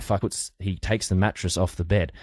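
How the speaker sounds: ordinary talk, nothing marked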